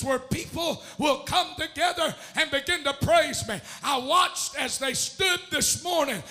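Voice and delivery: sermon cadence building in force; preaching. Real, not acted. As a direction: preach with rhythmic, gasped phrase endings.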